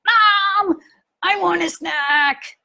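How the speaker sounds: put-on high, slow whining child; a child whining for a snack; whiny kid demand. Son whining at mom after school, high and drawn out.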